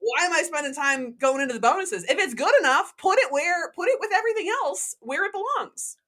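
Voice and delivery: exasperated rant. Real, not acted. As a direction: build up the frustration. Ranting about bonus folders.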